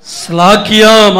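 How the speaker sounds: drawn out slowly, ஸ்லாக்கியம் stretched; lingering on ஸ்லாக்கியம் for weight; slow emphasis. Real, not acted. Preacher to church, slow drawn-out ஸ்லாக்கியம். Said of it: "அது அவர்களுக்கு கிடைத்த".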